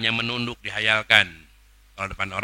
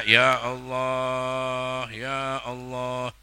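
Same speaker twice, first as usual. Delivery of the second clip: long stretched call to Allah; drawn-out cry; 2 semitones higher, much slower than usual. Shown as a demonstration. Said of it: "Saya baca". (seruan panjang yang ditarik)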